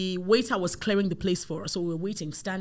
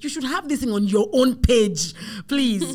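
louder, higher, each word pressed; insistent plea; 5 semitones higher, 8 dB louder than usual. Retold or imitated. Client urging her to post her work, emphatic. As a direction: urge it firmly, landing on own page.

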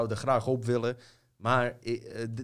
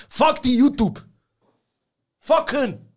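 yelling in real anger; furious shout; 14 semitones higher, 11 dB louder than usual. Real, not acted. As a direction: shout it out, fed up. Rant against YouTube censorship.

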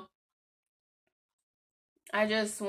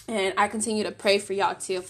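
usual speaking voice

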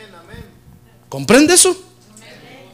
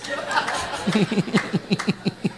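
breathy laugh; 9 dB quieter than usual. Real, not acted. Pastor laughs at own joke.

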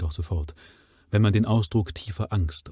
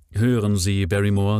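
stern; 6 dB louder than usual. A narrator's reading. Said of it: "sagte Sir Henry ernst".

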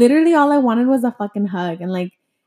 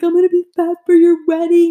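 crying on the phone; 8 semitones higher, 4 dB louder than usual. Retold or imitated. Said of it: "I was, like, crying".

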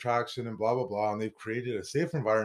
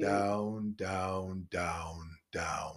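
each down lower and softer, fading away; dramatic fade; 5 semitones lower, 8 dB quieter, much slower than usual. Acted. Dramatic falling narration.

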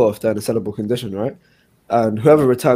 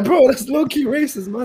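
laughing protest; 14 semitones higher than usual. Retold or imitated. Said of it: "I was like".

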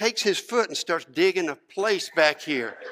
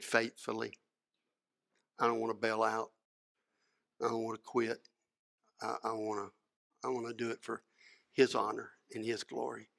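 low, hushed personal vow; quiet, earnest and halting; solemn sincerity; 7 semitones lower, 12 dB quieter than usual. Retold or imitated. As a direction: answer softly, pausing between phrases. Preacher's solemn answer to pastors.

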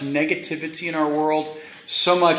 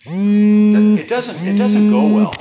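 higher, louder emphatic warning; 8 semitones higher, 9 dB louder, slower than usual. Real, not acted. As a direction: punch it out to the congregation. Emphatic warning, then softly repeated.

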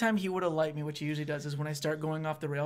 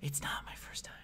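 unvoiced aside under breath; whispered aside; 11 dB quieter than usual. Real, not acted. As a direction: whisper it to the side.

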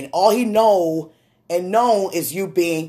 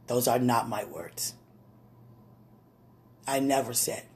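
hushed denial; 5 semitones lower, 7 dB quieter than usual. Acted. Daughter's hushed plea to God.

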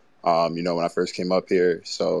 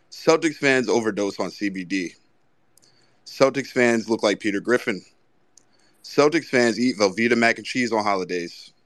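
a lightly performed poem mocking Celtics fans; mock haiku reading; 3 semitones higher than usual. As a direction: recite each insult like poetry.

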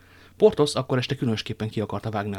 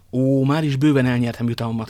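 fervent exclamation; 3 semitones higher, 7 dB louder than usual. A narrator's reading. Young lover thanking the woman, fervent exclamation. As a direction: exclaim it from a full heart. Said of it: "kiáltott fel D'Artagnan".

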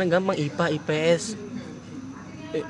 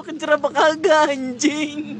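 usual speaking voice

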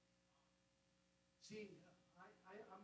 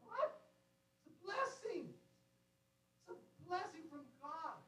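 awed; 7 semitones higher, slower than usual. Real, not acted. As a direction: savour the word blessing. Marvelling at God's gift.

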